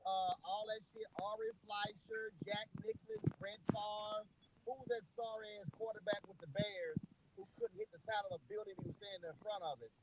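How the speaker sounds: distant, muffled voice on speakerphone; sounding far from the mic; far away. Real, not acted. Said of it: "Why you sound so far away?".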